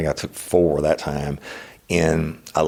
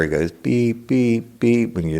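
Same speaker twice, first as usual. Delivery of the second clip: evenly spaced imitated beeps, slightly raised; normal beeps; 6 semitones higher than usual. Explaining a normal thermometer, steady imitated beeps. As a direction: copy a calm thermometer beep. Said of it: "those digital thermometers".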